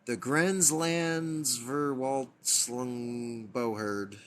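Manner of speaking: sounding out Grenslandsverwaltslungboherd syllable by syllable, very slowly; struggling with a long word. Real, not acted. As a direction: stumble slowly through Grenslandsverwaltslungboherd. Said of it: "can you guys read that word?".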